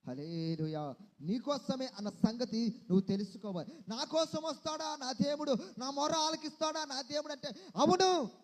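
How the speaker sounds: loud, driving sermon cadence; fervent preaching. Acted. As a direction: preach it out to the whole hall.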